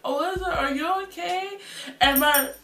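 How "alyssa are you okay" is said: worried coworker. Retold or imitated. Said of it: "they was like".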